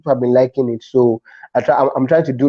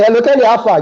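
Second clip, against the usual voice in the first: casual caller; 9 semitones higher, 3 dB louder, faster than usual. Retold or imitated. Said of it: "the tone is".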